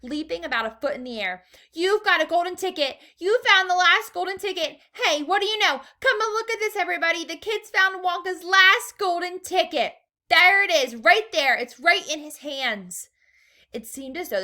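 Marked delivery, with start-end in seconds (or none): 1.7-13.1 high, loud, breathless shopkeeper; excited shouting. A narrator's reading. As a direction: yell it to the whole shop, beside yourself. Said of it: "screamed the shopkeeper".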